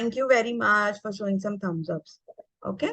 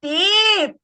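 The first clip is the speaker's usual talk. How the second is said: excited praise; 9 semitones higher, 5 dB louder than usual. Real, not acted. Student finds new word.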